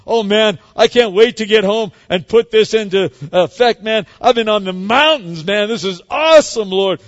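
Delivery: loud, high, thrilled after the retreat; excited gushing. Acted. Believer home from retreat gushing, loud and thrilled. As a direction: burst with mountaintop joy.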